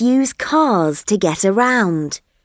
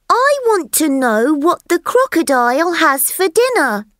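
higher, eager little elephant voice; curious elephant child; 9 semitones higher than usual. Acted. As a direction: ask like a wide-eyed young elephant. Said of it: "said the elephant's child".

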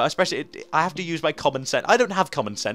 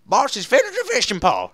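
high, loud, badly faked Southern American accent; 10 semitones higher, 6 dB louder than usual. Shown as a demonstration. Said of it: "I'm trying to do a Southern American accent".

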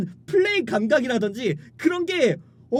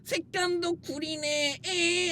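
taunting fanboy; 4 semitones higher, 5 dB quieter, slower than usual. Retold or imitated. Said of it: "하면서 이제 서로 치고받고 싸웠던".

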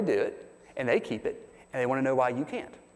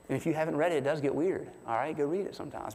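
usual speaking voice

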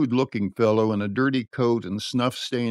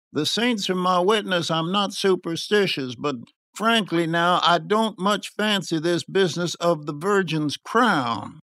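higher-pitched superstitious confiding; 7 semitones higher than usual. A narrator's reading. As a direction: confide your misgivings while denying them.

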